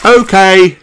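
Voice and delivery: high, loud character voice; eager Miss Rabbit. A narrator's reading. Miss Rabbit agrees to take the boat over, eager. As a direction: agree loudly, pitched well up.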